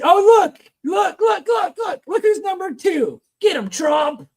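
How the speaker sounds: high, excited exclaiming, repeated looks; excited cheering. Real, not acted. Host spots the tariff list and cheers on the president, excitedly. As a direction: pitch it way up, egging him on.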